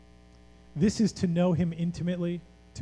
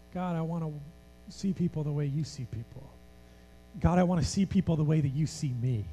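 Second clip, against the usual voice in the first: lower, softer prayerful voice; quiet prayer; 6 semitones lower, 6 dB quieter than usual. Acted. A preacher leading the congregation in prayer, quiet and earnest. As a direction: pray it softly and earnestly to God.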